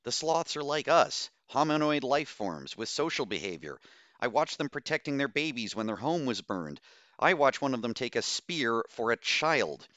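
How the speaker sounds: exclaiming. A narrator's reading. Doctor defends the sloths to her crew, impassioned exclamation. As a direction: argue it with rising heat. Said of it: "Bose exclaimed".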